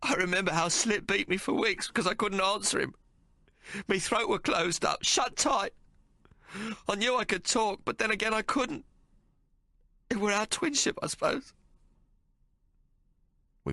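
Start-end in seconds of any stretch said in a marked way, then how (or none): 0.0-11.5 high, wounded brother's voice; Tomo, hurt. Acted. Tomo telling Hawk of Slit's beatings, high and hurt. Tomo's voice held.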